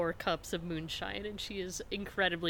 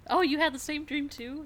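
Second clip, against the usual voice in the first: high, loud mock surprise; surprised; 9 semitones higher, 7 dB louder than usual. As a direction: act shocked at the news.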